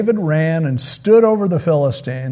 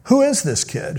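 much higher quizzical question; puzzled surprise; 8 semitones higher than usual. Retold or imitated. Saul wondering about David, high surprised question. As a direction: Saul asking about the boy. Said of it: "Saul is like".

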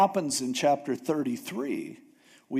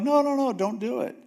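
urgent pleading; 9 semitones higher than usual. Retold or imitated. Moses pleads with God.

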